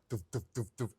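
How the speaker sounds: punchy, evenly spaced "doof" noises; short vocal thuds in a row; connecting-dots sound. Retold or imitated. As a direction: imitate dots landing one by one. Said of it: "I connected the dots of synchro".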